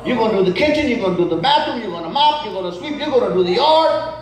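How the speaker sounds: a stern mother handing out chores; mother laying down the law. Retold or imitated. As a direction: rattle off the chores like a strict mom. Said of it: "She said".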